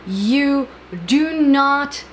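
"you do not" drawn out slowly, each word hit; stress on DO NOT. Real, not acted. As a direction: lean hard on "do not", spoken very slowly. Emphatic point to viewers.

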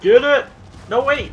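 high, loud excited yells; shouting. Real, not acted. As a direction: shout at the animal mid-chase. Finds the tapir.

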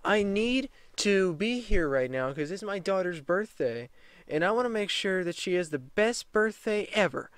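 high, whiny mimic of a pleading mother; mocking sob-story whine; pleading Karen. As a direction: whine for sympathy. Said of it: "the person was like".

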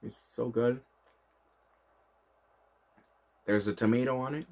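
chewing while talking; talking between bites; mouth full of candy. Real, not acted. Seen 0: Bites gummy pizza candy at start, chews while talking, looking down.

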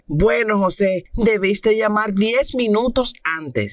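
high, slow wife's reply. Acted. Esposa responde al marido. (voz de esposa, aguda y lenta)

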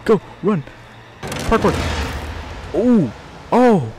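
high panicked cursing; being chased. Real, not acted. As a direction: swear in panic while running. Fleeing monster, panicking.

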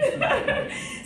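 short airy laugh; laughing about online meetings; breathy laugh. Real, not acted. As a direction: laugh at the shared joke. Laughs about Zoom drill.

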